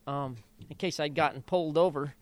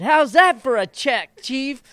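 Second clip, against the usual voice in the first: triumphant; 9 semitones higher, 9 dB louder, slower than usual. Retold or imitated. Triumphant after winning race.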